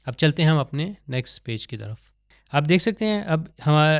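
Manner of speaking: ordinary talk, nothing marked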